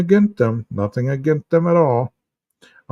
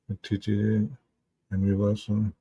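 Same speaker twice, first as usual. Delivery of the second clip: put-on stern teacher voice; 3 semitones lower, 9 dB quieter than usual. Reading chat comment in voice.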